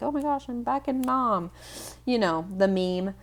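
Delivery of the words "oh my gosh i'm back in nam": mock freak-out. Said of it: "people freaking out".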